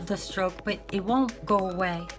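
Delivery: usual speaking voice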